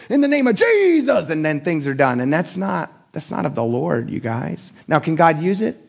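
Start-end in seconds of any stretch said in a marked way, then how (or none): 0.1-1.3 hammed-up healing command, soaring on the name; mock faith healer. Preacher mimicking TV healers pushing people back, mock faith-healer cry.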